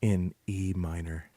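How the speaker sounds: slow, low, hushed radio announcer; NPR announcer. Pretend. Joking to his wife about how NPR sounds, a slow low radio-host voice. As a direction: intone it like a classical station host, slowly. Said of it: "the N P R?".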